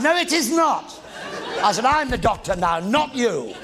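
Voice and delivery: laughing mock indignation; scolding Pat on set in mock outrage; mock outraged shout.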